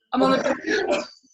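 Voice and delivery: mocking the ex for crying while she laughs; laughing mock sob. Ex on verge of tears, mocked with laughing. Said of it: "and he's like".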